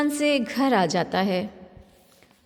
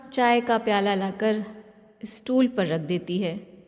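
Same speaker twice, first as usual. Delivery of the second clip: ordinary delivery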